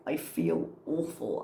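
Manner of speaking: usual speaking voice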